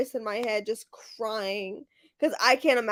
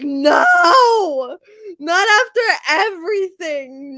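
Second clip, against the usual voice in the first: high, loud, sobbing cry of no; crying shout; 6 semitones higher, 8 dB louder, slower than usual. Retold or imitated. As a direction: wail it out through tears. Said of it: "I was like".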